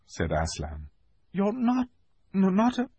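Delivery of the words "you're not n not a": put-on very high, soft trembling girl; stammer, afraid of the word; shaky voice. A narrator's reading. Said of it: "asked Susan in a shaky voice".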